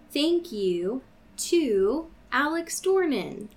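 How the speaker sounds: ordinary talk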